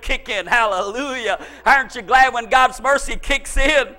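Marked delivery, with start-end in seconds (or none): none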